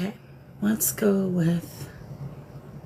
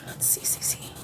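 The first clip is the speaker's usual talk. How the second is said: whispering; 11 dB quieter than usual. Real, not acted. Muttering to herself while searching.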